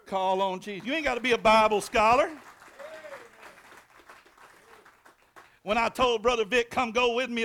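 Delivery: preacher rousing the congregation again; full-force preaching. Acted. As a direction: drive it home to the pews.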